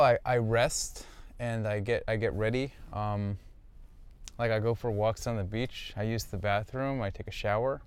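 ordinary talk, nothing marked

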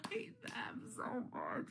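hushed whisper of disgust. Real, not acted. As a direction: breathe it out as a whisper. Venting hatred for houseguests.

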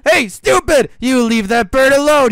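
loud, high shout at the bully; angry yell. Acted. Little bird defending another from the big bird, loud angry yell. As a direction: yell to make him let go.

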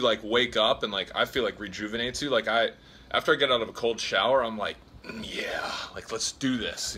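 ordinary talk

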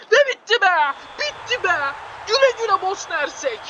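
high, joyful cry of relief; triumphant relief. Real, not acted. Gamer cheering the final whistle in relief.

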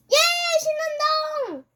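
very high, drawn-out gleeful cheer; thrilled. Real, not acted. Player celebrating the win, thrilled. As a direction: squeal it with real delight. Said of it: "왜 이렇게 신나?".

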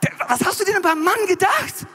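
high-pitched, worked-up griping; hammed-up indignant rant; exasperated complaint. Eve complains to God about her man.

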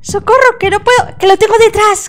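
frantic, high, loud cries for help; alarm shout. Real, not acted. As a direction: shout in panic, being chased. Ghost right behind her.

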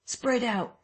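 high urgent call; crying out. Acted. Someone in the crowd calls to the chasers, high. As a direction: shout orders to the chasing crowd. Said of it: "cried someone".

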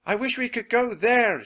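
high, loud, urgent wish; shouted wish. A narrator's reading. Annie points at the book, shouting her wish. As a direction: shout it over the wind. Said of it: "she shouted".

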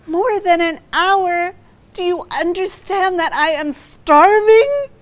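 starving woman's incredulous complaint; indignant disbelief. Retold or imitated. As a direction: protest as if it's the last straw. Hungry, told tow is delayed.

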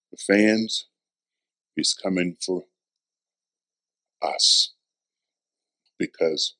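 quiet intimate preaching; hushed sermon. Acted. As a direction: lean in and whisper the truth to them. Hushed low preaching run.

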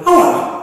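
sharp high yelp of pain; cry of pain. Acted. Kicked ox yells at the donkey: high pained yelp. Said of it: "dann schrie der Ochse".

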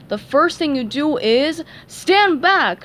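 big lobster lifeguard calling out; shouted warning. Acted. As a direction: bark it from the lifeguard stand. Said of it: "shouted Larry the Lobster".

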